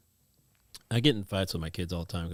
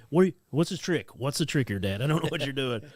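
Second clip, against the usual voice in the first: higher, louder mock child voice, wary; dad voicing his suspicious son asking what the trick is; suspicious kid; 6 semitones higher, 4 dB louder than usual. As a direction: play the boy who smells a trick.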